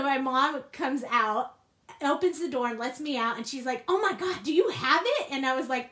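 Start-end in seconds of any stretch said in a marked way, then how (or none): 3.9-5.3 higher, louder eager question; breathless hope; anxious excitement. Retold or imitated. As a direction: mom asks if it's caught. Mom anxious about the squirrel.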